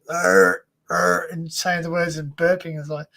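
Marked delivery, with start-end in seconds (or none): 0.1-1.3 low, loud mock burps; imitated belching. Retelling a talent-show singer's act, imitated belching. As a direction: belch out two deep fake burps. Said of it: "she just starts belching".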